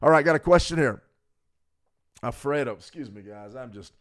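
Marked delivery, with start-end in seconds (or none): 2.7-3.9 much softer, throat catching, apologising; choked up. Real, not acted. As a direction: clear a smoky throat and push the words out quietly. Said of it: "absolutely sort of choked up".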